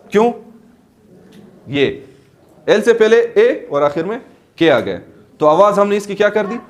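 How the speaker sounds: ordinary talk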